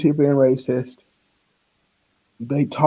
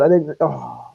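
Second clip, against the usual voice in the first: ordinary talk, nothing marked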